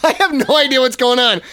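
ordinary talk

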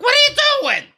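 very high, incredulous squeal; mock disbelief. Host mocking his own reaction to makeup during lockdown, very high disbelief. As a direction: act stunned at someone putting on makeup at home. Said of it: "I'm like".

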